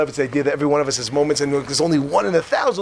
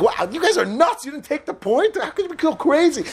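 mock incredulous Yisro; 9 semitones higher than usual. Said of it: "he hears it, like".

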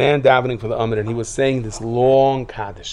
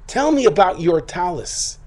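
slower, drawn-out, insistent demand; slow mock-stern repeat; 7 semitones higher, slower than usual. Mother-in-law pressing her son again, slowly and sternly.

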